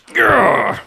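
high, loud, hammed-up "grr"; Benny Hill style pie-in-face growl; comic growl. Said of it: "the funny thing that Benny Hill would do".

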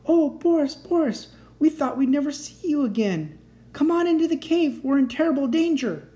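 high, loud joyful mother voice; overjoyed to hear him; excited mother. Acted. Mother reunited with son.

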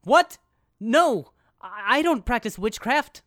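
high startled protest; defensive. Retold or imitated. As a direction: yelp a panicked denial.

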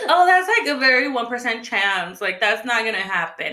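mock a dismissive employee, starting high and loud; lightly put-on dumb voice, stressed and dragged out. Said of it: "And her dumb voice".

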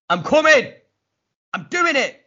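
excited. Retold or imitated. Matt's excited phone call, voiced.

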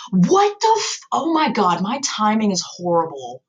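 high-pitched, exasperated disbelief; appalled outburst. Real, not acted. Writer catching her own horrible timeline, appalled outburst.

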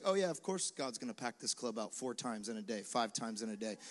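offhand nonchalance. Pretend. Preacher picturing packed club, shrugging it off casually. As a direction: toss it off as if nothing special happened. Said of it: "I was like".

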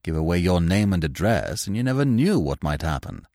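ordinary delivery, nothing marked

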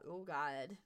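ordinary delivery

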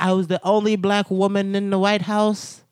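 imitating Omarosa's tell-all proclamation; mock speech. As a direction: proclaim it like a grand statement. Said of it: "She's like".

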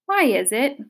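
ordinary delivery

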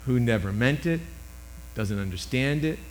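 ordinary delivery

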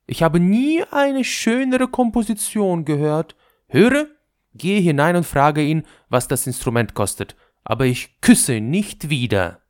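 hoch und laut, verwöhnte Prinzessin; herrisch-verzückte Prinzessin; hohe Prinzessinnenstimme. A narrator's reading. Prinzessin befiehlt der Hofdame. (hohe, verwöhnte Prinzessin)

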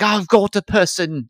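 squeaky high, slow announcement; high-pitched mock eagerness; high excited mock voice. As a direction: pipe it up high like an excited official.

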